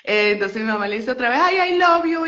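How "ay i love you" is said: high, loud gushing mom; mother gushing to Shaquille. Retold or imitated. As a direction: swoon a little as you say it.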